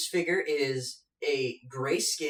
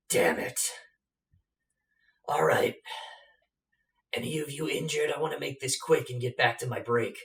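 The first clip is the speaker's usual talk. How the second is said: a bored, put-upon giant on his break; weary giant. Acted. Giant to adventurers, annoyed at the interruption, weary and put-upon. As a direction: grumble it like a guard who wants his break back. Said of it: "he says".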